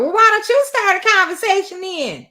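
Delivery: high, loud mock mimicry; mocking imitation. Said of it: "this hoe gonna talk about".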